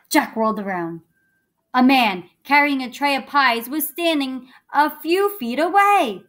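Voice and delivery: lively storybook reading; reading to children. A narrator's reading. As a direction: read it like a bedtime story.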